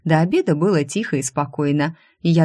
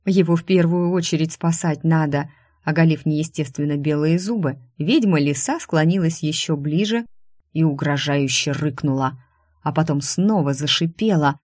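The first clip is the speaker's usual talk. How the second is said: ordinary delivery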